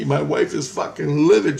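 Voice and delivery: ordinary delivery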